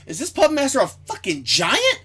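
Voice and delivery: high, incredulous question; baffled reader's exclamation, retold; retold bafflement. Retelling own baffled reaction while reading.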